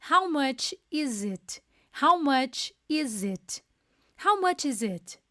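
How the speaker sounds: "How much is it?" repeated slowly with a falling end; repeated pronunciation demo. Shown as a demonstration. Teacher drilling the class on the question, slow and falling. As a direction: drill the phrase slowly, falling each time. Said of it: "Olha a pronúncia".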